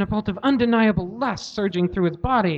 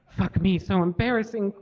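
usual speaking voice

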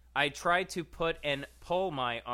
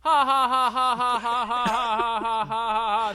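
maniacal laugh; 8 semitones higher, 11 dB louder than usual. Acted. Acted story, mad laughing.

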